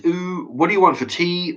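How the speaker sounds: high, lilting mock-sweet question; high sing-song mock. Viewer mocking a parent asking what's for tea, high sing-song. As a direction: lilt it up high like a doting parent. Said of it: "Sweep sings".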